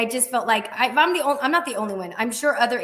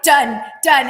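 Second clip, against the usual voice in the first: loud quick done done; eager agreement; 10 dB louder than usual. Retold or imitated. [name] eagerly accepting the caller's ten-lead offer, loud. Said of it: "I'm just like".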